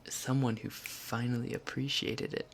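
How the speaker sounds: ordinary talk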